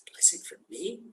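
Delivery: an astonished whisper; whispered disbelief. Acted. Old janitor stunned that rabbis want his blessing, whispered. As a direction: breathe it out, stunned.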